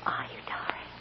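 soft tender whisper. Acted. Mother asking her daughter softly if she is glad. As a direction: ask it gently, barely voiced.

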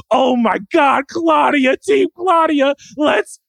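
high fan scream, stretching the last word; hyped-up cheering. Retold or imitated. Fan cheering on a favourite character, hyped. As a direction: build to a drawn-out victory yell. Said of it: "I was so hyped".

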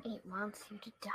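spooky whisper. Acted. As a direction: hiss it like a creepy secret. Kid whispers about soda.

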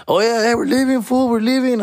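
high put-on voice, casual call-out; friend announcing. Friend telling Jerry they're leaving, high mock call.

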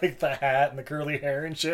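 normal talking voice

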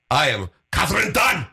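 mock German newsreader announcing a name; over-articulate it in a German accent. Shown as a demonstration. Host showing co-host how to announce herself, articulate German accent. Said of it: "in a very articulate accent".

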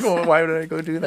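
loud, high, laughing mock outrage; laughing exasperated quote. Podcaster retelling his reaction to new mics, laughing loudly. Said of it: "I'm like".

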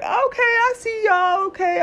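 way up high, playful knowing okay; sing-song teasing approval; knowing tease. Retold or imitated. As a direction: tease them with a sly I see you. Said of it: "that one smile".

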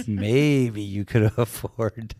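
loud, laughing mock advice, slowing down in the middle; telling the agent off, laughing; laughing jab. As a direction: crack up while delivering the put-down. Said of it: "And I'm like".

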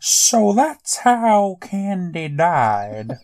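teasing the co-host's line back, slow and sarcastic; mocking repeat. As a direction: draw it out to mock the line. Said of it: "So shut up, Kiki".

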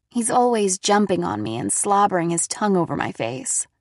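plain reading voice

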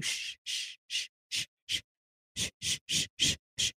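repeated insistent shushes; soft string of mock shushes; imitated shushing. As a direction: hush the car over and over. Imitates caller shushing the car.

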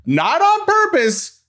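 high, slow mock insistence; mock emphatic. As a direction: stretch it out, playfully insistent.

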